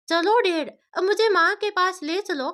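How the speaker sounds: put-on high, whiny child's plea; a small girl tugging at her dad; pleading little girl. A narrator's reading. Daughter begs dad to take her to mom.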